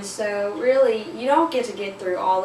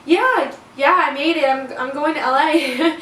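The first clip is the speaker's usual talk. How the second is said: high, loud bright lie; fake cheerful; 6 semitones higher, 7 dB louder than usual. Girl pretending to a family that she passed, fake cheerful. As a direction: beam through the lie. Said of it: "I didn't want to tell them".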